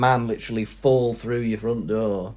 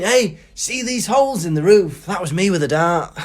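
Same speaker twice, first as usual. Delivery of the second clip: higher, louder boasting with a laugh; proud drunk; 8 semitones higher, 4 dB louder than usual. Retold or imitated. Drunk man boasting about dart holes, high and laughing. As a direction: show off like a tipsy lad.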